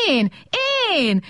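high mock shriek. A narrator's reading. Small robot charging the big one, shrieking. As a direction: squeal it like a frantic little robot. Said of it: "sikoltozott a kis robot".

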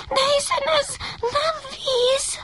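high, slow frightened whimper; scared puppy whining up in the sky. A narrator's reading. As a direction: whine it out in fear of the height. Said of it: "vinnyogott a kiskutya".

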